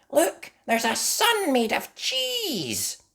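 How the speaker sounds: very high, slow pointing out; delighted Mandy. Acted. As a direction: point it out in wonder.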